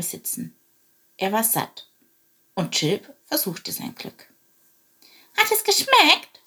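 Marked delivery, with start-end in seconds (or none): 5.4-6.4 high, polite little sparrow voice; polite little bird. Acted. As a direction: ask sweetly, like a tiny bird. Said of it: "fragte er höflich".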